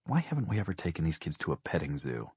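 soft, inward musing; wondering to herself. A narrator's reading. Mother wondering to herself, softly musing. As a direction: voice it as a quiet private thought. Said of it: "she wondered".

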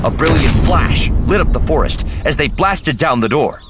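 high, loud climactic reading; dramatic narration. A narrator's reading. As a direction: build it to the blast.

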